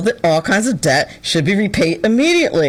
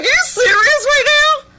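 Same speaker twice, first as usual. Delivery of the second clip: much higher, exasperated disbelief; incredulous. Retold or imitated. Reading the maddening article, high incredulous outburst. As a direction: sound fed up and stunned. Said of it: "I was like".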